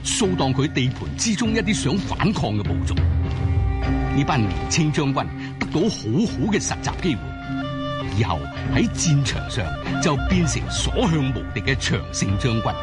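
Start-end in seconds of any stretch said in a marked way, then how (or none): none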